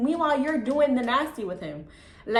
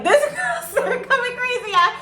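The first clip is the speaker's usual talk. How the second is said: laughing; 13 semitones higher, 5 dB louder than usual. Real, not acted. Laughing at the absurd fight.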